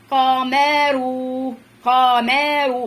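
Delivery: قمر in linked, held syllables without stopping; each vowel separate. Shown as a demonstration. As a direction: roll through قمر like a train, syllable after syllable. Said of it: "يلا كل حركة لوحدها سريعا يا قطر".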